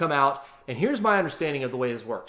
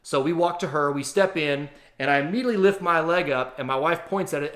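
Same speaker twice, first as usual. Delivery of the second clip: ordinary talk, nothing marked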